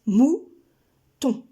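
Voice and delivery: mou-ton said loud, each syllable pushed out; stressed syllables. Shown as a demonstration. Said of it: "bien insister sur les syllabes prononcées".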